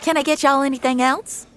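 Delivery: high, bright diner waitress; waitress checking on the table; cheery waitress. Acted. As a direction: chirp like a friendly waitress.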